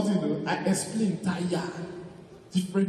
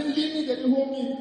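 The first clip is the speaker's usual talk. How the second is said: mock wail; 9 semitones higher than usual. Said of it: "I say".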